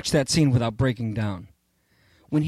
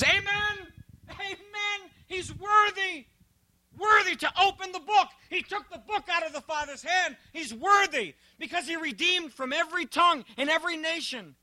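very high, hushed cry of worship; heavenly living creatures; 14 semitones higher, 3 dB quieter than usual. Acted. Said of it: "The four living creatures".

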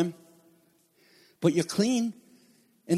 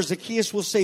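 ordinary talk, nothing marked

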